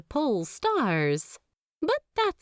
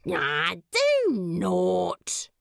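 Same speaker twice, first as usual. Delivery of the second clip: slow, dragged-out brush-off; dismissive groan; much slower than usual. Acted. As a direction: wave off the thanks like a mosquito. Demon swats gratitude away.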